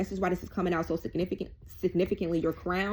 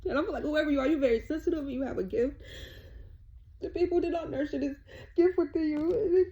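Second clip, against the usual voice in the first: high, tearful voice breaking into sobs; real crying; 7 semitones higher than usual. Real, not acted. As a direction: overcome with emotion while trying to keep talking. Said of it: "ooh, I don't cry on my readings".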